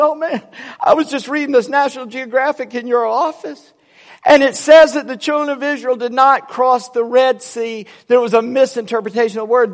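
imitating an overexcited man, high-pitched; excited carrying on. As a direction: gush it high, settling at the end. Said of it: "shouting and carrying on".

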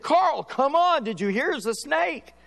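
high, urgent hurry; urgent alarm. Retold or imitated. Retelling calling his friend to the snake, urgent. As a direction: come on, there's a snake, hurry up.

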